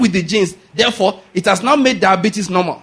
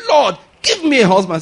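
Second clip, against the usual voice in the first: mock earnest cry to God; imitated fervent prayer. Preacher imitates woman kneeling to pray, fervent plea. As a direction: call out the plea as she would. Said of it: "she kneels down and prays".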